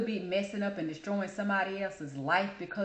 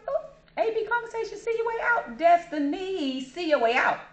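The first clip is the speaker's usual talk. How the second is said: very high, teasing mock commentary; high-pitched playful egging-on; retold cheeky aside; 10 semitones higher, 3 dB louder than usual. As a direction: pipe up in a high little voice. Own reaction cheering the clapback.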